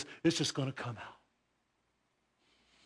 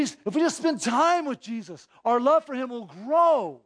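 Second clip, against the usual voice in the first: raised, driving sermon voice; fervent preaching; 5 semitones higher than usual. Acted. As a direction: preach it with rising fervor. Preacher builds intensity.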